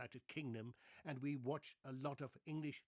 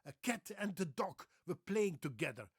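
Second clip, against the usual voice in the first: put-on high, loud Dutch-accented sentence; exaggerated Dutch English, cat as ket, dog as dok; 5 semitones higher, 6 dB louder than usual. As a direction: play a Dutchman reading English. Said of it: "They say".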